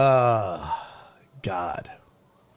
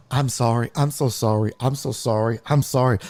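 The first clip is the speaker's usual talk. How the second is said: apologetic; 6 semitones lower than usual. Retold or imitated. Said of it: "she's like".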